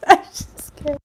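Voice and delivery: real laughter breaking through; laughing. Real, not acted. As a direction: giggle the words out. Giggle fit during reading.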